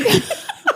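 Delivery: sudden high-pitched laugh; rising laugh. Real, not acted. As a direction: burst into a bright laugh. Laughs at sarcastic question.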